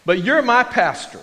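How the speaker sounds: an excited listener meeting his radio preacher; eager fan. Pretend. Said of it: "and they said".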